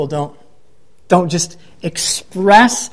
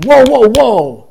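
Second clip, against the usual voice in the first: higher, louder, drawn-out protest; upset Pharisees objecting; 4 semitones higher, 5 dB louder, slower than usual. Acted. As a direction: cut in to halt them, slow and loud. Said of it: "the Pharisees are upset".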